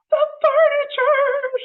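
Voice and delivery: high, loud, drawn-out cry of shock; hammed-up horror at the furniture; crying out in dismay. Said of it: "she cried".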